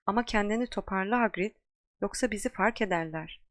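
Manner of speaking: ordinary delivery, nothing marked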